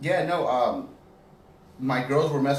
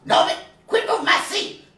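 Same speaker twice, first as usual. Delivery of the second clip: very high, loud Rasputia voice; Rasputia impression; 6 dB louder than usual. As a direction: bellow it like Rasputia. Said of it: "Do the roar".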